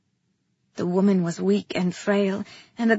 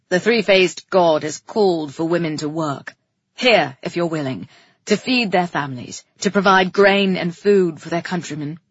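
king's stagy, lowered proclamation; loud public voice; 4 semitones lower, 5 dB louder than usual. A narrator's reading. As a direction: address the crowd with pageantry. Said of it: "He was still using his loud voice".

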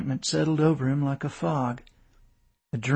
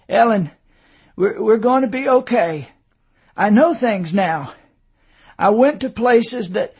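higher, loud, halting urgency; urgent insistence; 7 semitones higher, 7 dB louder than usual. A narrator's reading. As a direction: struggle to make his wife understand. Dazed husband urgently insisting.

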